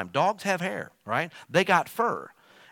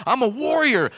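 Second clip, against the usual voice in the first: higher, louder chest-out boast; warrior boast; 10 semitones higher, 8 dB louder than usual. Retold or imitated. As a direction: crow it like a champion. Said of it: "He's talking like this".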